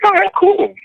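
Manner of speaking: high, bright mock-wife chirp; chirpy and clueless; high airy girlfriend voice.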